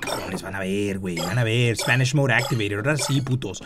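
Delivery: low, fast bravado in Spanish; cocky Spanish hype-up. Real, not acted. Gamer psyching himself up in Spanish, low cocky bravado. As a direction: boast rapidly in a dropped pitch. Hyping self up in Spanish mode.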